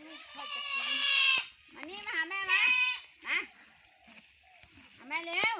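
high coaxing call to a calf; sing-song calling the calf over. Real, not acted. (เรียกลูกวัวเสียงสูงแบบโอ๋)

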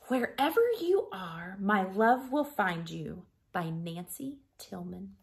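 ordinary delivery, nothing marked

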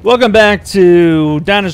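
usual speaking voice

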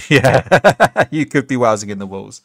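loud burst of laughter into the reply; amused, laugh-broken agreement. Real, not acted. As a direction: agree through a laugh, settling down. Laughs at a chat comment.

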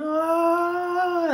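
high falsetto cartoon scream; mock ejector-seat scream; imitated scream. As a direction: squeal it like he's being launched. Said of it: "he's screaming".

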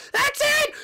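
piercing high, loud shriek; furious snap. Acted. Peach losing patience with fans, furious shriek. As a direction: shriek it at the top of your range.

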